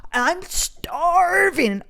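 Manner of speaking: high, hugely drawn-out wail of hunger; hammed-up starving complaint, very slow; May. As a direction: stretch STARVING into a high dramatic whine. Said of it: "I just kinda sounded like May".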